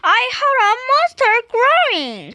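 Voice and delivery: high-pitched, alarmed dragon; high, urgent report of danger; frightened cry. A narrator's reading. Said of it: "cried Dragon".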